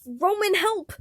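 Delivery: high, loud plea for help; desperate cry. A narrator's reading. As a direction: beg Roman to help. Virgil screaming for help.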